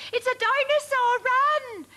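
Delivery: high, loud panicked yelp; terrified yelp. A narrator's reading. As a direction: yelp and flee from the monster. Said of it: "yelped the fourth".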